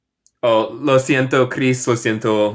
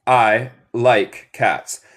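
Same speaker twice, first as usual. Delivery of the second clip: I like cats drawn out very slowly; deliberate classroom model sentence; slow teaching model; much slower than usual. Shown as a demonstration. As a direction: say it slowly for learners. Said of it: "A mí me gusta gatos".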